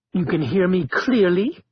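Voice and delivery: through clenched teeth; words forced through teeth; gritted teeth. Acted. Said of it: "between gritted teeth".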